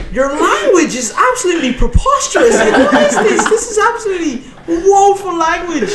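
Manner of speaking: very high, loud, prim posh outrage, slowing at the end; be scandalised in the high O'Shane voice; O'Shane outraged. Acted. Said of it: "He's just like".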